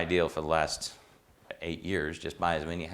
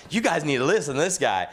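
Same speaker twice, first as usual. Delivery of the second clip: higher and louder, calling out to the group; mock announcement; 9 semitones higher, 5 dB louder than usual. Old mentor calling to the cruise group about the student, high excited call. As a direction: show him off to everyone.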